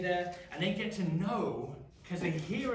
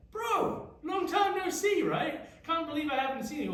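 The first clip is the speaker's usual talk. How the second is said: high, loud overjoyed hail to a long-lost brother; excited greeting; 12 semitones higher, 5 dB louder than usual. Retold or imitated. Tribesmen greeting a long-lost brother, high excited shout. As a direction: shout it like spotting a friend across a crowd. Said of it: "they would have said".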